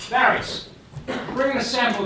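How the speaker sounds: calling out. Acted. Calling servant from room.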